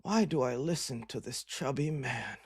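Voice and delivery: a low, soft mock viewer voice; mock viewer thought. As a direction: mutter it like a bored viewer staring at the ceiling. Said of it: "looking at the ceiling thinking".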